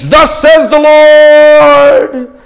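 slow, high, loud prophetic proclamation; solemn booming prophet voice; prophet's pronouncement. Acted. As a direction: draw out each word like an oracle. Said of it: "he then speaks".

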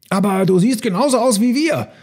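high, amazed elephant voice; astonished. A narrator's reading. Herd sees Elmar looking like them, high astonishment. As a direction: marvel in a high voice. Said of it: "staunten die anderen".

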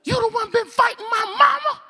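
high, loud strained shout; accusing yell. Retold or imitated. Inmate to new arrival about his mama, high accusing yell. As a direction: shout the accusation across the pod.

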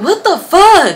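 high, loud, laughing exclamation; mock outraged shock. Man just hit by a cup, high mock outrage. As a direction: imitate a stunned man through laughter. Sleepy's reaction to being hit.

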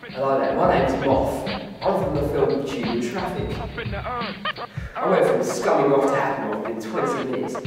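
usual speaking voice

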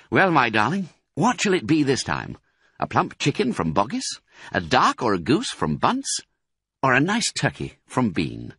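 lightly voiced charming fox; a hint of a suave husband asking; Mister Fox. Fox sweetly asking his wife what to steal, lightly voiced.

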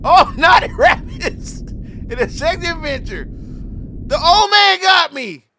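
slow, high laughing groan; laughing disbelief. Real, not acted. Streamer laughing at the joke ending, slow and high. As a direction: savor the absurd title while laughing.

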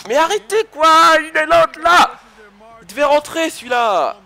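high, loud, slow outburst; angry shout. Real, not acted. Gamer raging at the screen, angry shout. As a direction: yell at the opponents in frustration.

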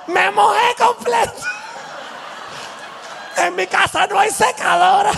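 very high, laughing squeal of a drenched guy; high-pitched giggly rant about wet clothes; laughing soaked Venezuelan. Retold or imitated. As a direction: play it as a joke while dripping wet.

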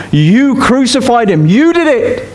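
pointed, slow accusation, pitch climbing; a preacher voicing Peter's charge; accusing Peter. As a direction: jab the words at the crowd.

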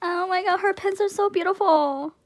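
high, loud gushing squeal; excited outburst. Real, not acted. Unboxer gushing to viewers over pins, excited outburst. Overwhelmed fan reacting to pins.

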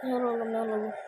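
muttering. Real, not acted. Low muttering at player.

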